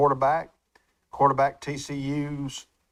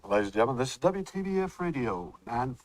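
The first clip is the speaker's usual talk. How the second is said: radio announcer. Acted. Calling out the station to listeners, announcer voice.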